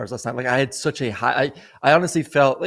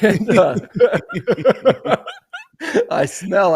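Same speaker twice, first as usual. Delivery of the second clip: breaking into high laughter; laughing; 8 semitones higher, 6 dB louder, slower than usual. Real, not acted. Laughing at himself while telling the co-host the story. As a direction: laugh through the words, voice jumping up.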